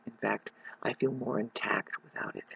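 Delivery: soft hushed whisper. Real, not acted. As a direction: say it under breath. Whispered aside.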